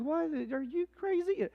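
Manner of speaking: high, disbelieving "what?"; incredulous. Retold or imitated. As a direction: soldiers refusing an absurd order. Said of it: "They're like".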